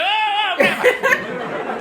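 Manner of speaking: high-pitched mock ranting grandparent; grandparent off the rails. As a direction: imitate an old relative suddenly losing it, very high. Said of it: "all of a sudden it went off the rails".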